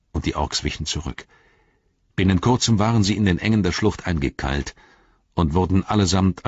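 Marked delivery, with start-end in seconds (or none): none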